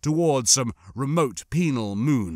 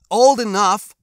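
defiant insistence; 9 semitones higher, 10 dB louder than usual. A narrator's reading. Boy insists on his age.

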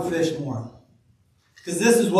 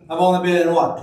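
usual speaking voice

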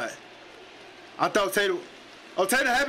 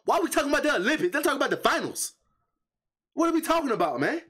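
exasperated rant; 9 semitones higher than usual. Real, not acted. Angry rant about the Olympics questions.

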